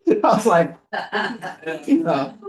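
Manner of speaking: laughing through the words; can't get it out for laughing; real laughter breaking up the line. Real, not acted. Laughing while retelling.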